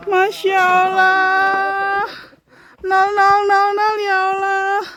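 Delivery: slow, high, crying words of thanks; tearful prayer. Real, not acted. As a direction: sob out thanks to God. Overwhelmed on reaching the summit. (berdoa sambil menangis)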